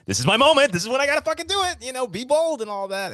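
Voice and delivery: high, fast nervous Woody Allen pep talk; Woody Allen inner voice. Retold or imitated. Imitating Woody Allen psyching himself up, high and fast. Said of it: "you can see Woody Allen say".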